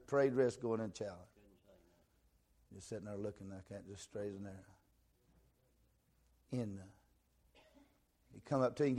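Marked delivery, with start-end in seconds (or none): none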